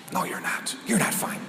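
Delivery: whispered thought to himself; inner whisper. Acted. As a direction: breathe it, unvoiced, as a private thought.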